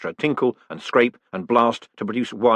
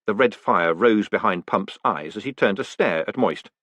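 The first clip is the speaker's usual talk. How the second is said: ordinary delivery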